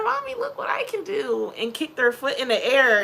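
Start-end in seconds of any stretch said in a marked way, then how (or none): 0.0-1.5 put-on high, loud whiny child voice; mock kid voice. Mom imitating her child begging her to watch, squeaky. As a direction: play the bragging little kid.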